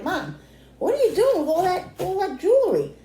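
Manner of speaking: high, loud mock-incredulous kid; teasing kid voice. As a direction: squeal it like a cheeky kid teasing mom.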